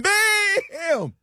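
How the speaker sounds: high, drawn-out burst of excitement; sudden high-pitched whoop on one word; exclaimed bam. Real, not acted. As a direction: punch it out high and stretch it. Imitating a big moment hitting.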